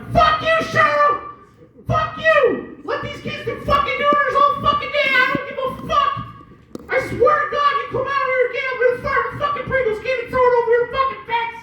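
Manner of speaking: very high cartoon mouse yelling curses; squeaky cartoon voice telling off the neighbour; Mickey Mouse. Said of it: "the killer Mickey Mouse impression".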